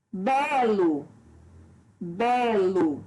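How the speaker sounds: "Belo" with a long, strong first syllable BE; stress on BE. Shown as a demonstration. Teacher demonstrating the tonic syllable, "Belo" stressed on BE. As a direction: lean on Be, let lo fall away in "Belo". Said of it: "A sílaba tônica é a sílaba bé".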